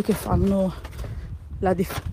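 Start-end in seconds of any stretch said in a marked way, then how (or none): 1.6-2.0 with hiccups. Real, not acted. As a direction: cut off by a hiccup. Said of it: "scusate, mi singhiozzo".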